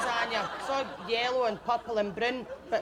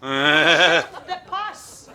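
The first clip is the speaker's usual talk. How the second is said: loud disgusted exclamation; 7 semitones lower, 11 dB louder than usual. Acted. Comic outraged exclamation.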